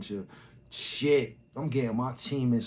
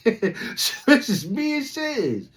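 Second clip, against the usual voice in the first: slow laugh through it; 11 semitones higher, 5 dB louder, much slower than usual. Retold or imitated. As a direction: laugh it out, disbelief. Repeated laughing, stretched.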